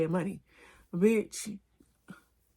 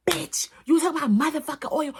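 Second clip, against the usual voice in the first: furious high shout; cussing him out; 10 semitones higher, 8 dB louder than usual. Retold or imitated. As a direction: yell it at the thief who stole your oil. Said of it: "I was cussing him out".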